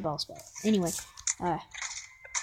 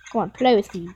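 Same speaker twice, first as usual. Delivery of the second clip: ordinary delivery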